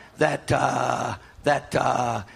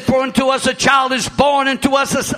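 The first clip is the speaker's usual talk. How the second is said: loud, high scripture reading; preaching scripture; 7 semitones higher, 6 dB louder than usual. Acted. Pastor reading scripture to the church, preaching. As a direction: proclaim the verse.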